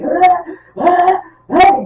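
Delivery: high, loud imitated screams; Nicolas Cage scream. Imitating the actor's famous scream, screaming. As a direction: let out repeated hammed-up shrieks. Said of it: "the famous Nicolas Cage scream".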